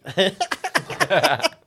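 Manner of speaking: loud, high cackle breaking out; high burst of laughter. Real, not acted. Laughs at own threat.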